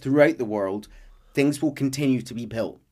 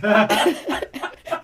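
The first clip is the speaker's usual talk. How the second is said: laughing; 9 semitones higher, 4 dB louder than usual. Real, not acted. Laughs at own joke.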